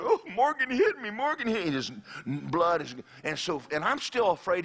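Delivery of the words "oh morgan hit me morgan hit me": put-on high-pitched wailing kid; imitated tearful tattling; mock crying. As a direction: wail it out like a hurt schoolboy. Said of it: "And he's crying".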